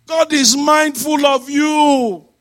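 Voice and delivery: high, loud, drawn-out sermon delivery; ringing pulpit proclamation; full-force preaching. Acted. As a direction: proclaim it slowly to the whole congregation.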